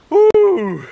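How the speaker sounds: yelp, then burst out laughing; startled yelp then laugh. Real, not acted. Soaked, laughing.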